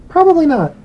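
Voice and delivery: high-pitched. Real, not acted. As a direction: toss it off high and loud. GM answers brightly.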